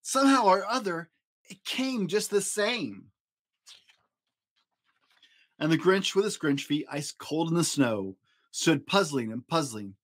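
usual speaking voice

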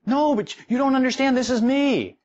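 high, loud insistent protest; indignant protest. Pretend. Person defending their false self, high loud protest. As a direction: insist hard that this is you.

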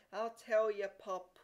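loud, righteous threat; self-righteous tattletale cry; virtuous grandeur. A narrator's reading. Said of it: "cried Peter in virtuous grandeur".

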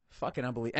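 ordinary talk, nothing marked

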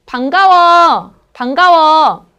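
bright casual hello to a friend; friendly greeting. Shown as a demonstration. Teacher showing how friends greet, upbeat and loud. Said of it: "O entre amigos".

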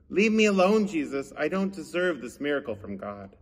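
usual speaking voice

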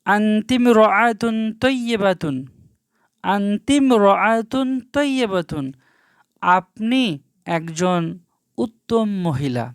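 unhurried teacher's demonstration, syllables drawn out; slow, careful reading. Shown as a demonstration. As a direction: read slowly, word by word. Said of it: "আমি ধীরে সুস্থেই পড়ছি".

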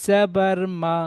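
each letter drawn out slowly and sung; slow teacher's chant of the alphabet; slow spelling drill. Shown as a demonstration. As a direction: chant the letters with their vowel, stretching every name. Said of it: "তাহলে এখানে পড়বেন".

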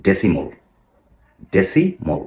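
ডেসিমল ending in a short "mol"; correct decimal. Shown as a demonstration. Teacher models the right ডেসিমল. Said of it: "মাল বলেন, এখানেও ভুল".

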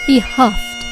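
usual speaking voice

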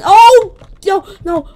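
sudden high, loud cry; explosive shocked exclamation; startled yell. Real, not acted. Startled in battle.

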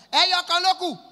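high, loud vendor's call; imitated food seller calling out; street hawker's shout. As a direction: holler your wares out loud. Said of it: "she go shout".

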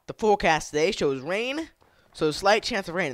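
playful mock weather report; Minecraft weather forecaster. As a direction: announce the forecast like a TV weather guy. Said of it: "like, a Minecraft guy. He'd be like".

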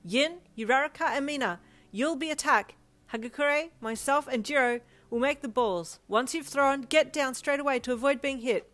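high, loud team leader's instructions; giving orders. A narrator's reading. Momo assigning jobs to her team, commanding loudly. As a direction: call out orders over the field. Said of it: "as she was giving orders".

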